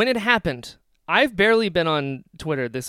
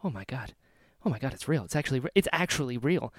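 much softer and lower, breathless disbelief; stunned hush; 6 semitones lower, 7 dB quieter than usual. Retold or imitated. Fan retelling his shocked reaction to co-host, hushed and stunned. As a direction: murmur it, stunned. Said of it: "I was like, heavy breathing".

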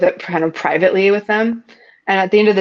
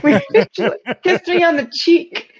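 laughing through the words, higher and slow; 9 semitones higher than usual. Retold or imitated. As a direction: giggle out the words in disbelief. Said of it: "she literally, that's all happened".

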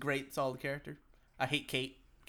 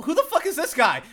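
high, loud laughing disbelief; cracking up in confusion; 12 semitones higher, 7 dB louder than usual. Real, not acted. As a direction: laugh through the question.

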